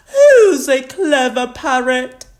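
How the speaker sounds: high, loud cooing to a pet; doting granny. A narrator's reading. Granny Pig beams at Polly, doting and high-voiced. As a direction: praise the parrot in a granny voice. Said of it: "beaming at Polly".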